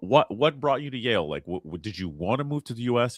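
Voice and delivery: ordinary talk, nothing marked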